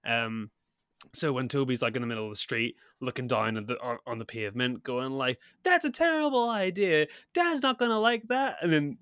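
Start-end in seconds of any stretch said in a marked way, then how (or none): 5.6-8.6 high-pitched, hammed-up film kid; mock kid's protest. Host mimics Toby talking to his invisible companion, mock protest. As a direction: imitate a boy objecting, pitched way up.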